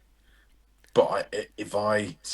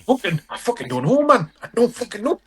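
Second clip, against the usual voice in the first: high-pitched bad Geordie; badly faked, swooping Geordie accent; attempted Geordie accent; 12 semitones higher, 4 dB louder than usual. Shown as a demonstration. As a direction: put on a hammed-up Geordie, pitched way up. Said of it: "I can't do Geordie".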